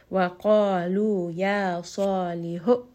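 Wa qalu ya salihu very slow, every mad held evenly two counts. Shown as a demonstration. Teacher reciting to show equal mad lengths, slow and steady. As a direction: hold each vowel in Wa qalu ya salihu the same two counts. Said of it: "Lu is a two count mad".

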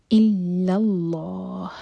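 الا اللہ with a heavy, full لام in اللہ; slow recited الا اللہ; heavy lam. Shown as a demonstration. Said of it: "لام جلالہ heavy ہوگا".

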